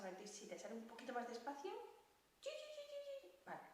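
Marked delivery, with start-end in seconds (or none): 2.4-3.3 squirrel chatter. Pretend. Squirrel squeaks.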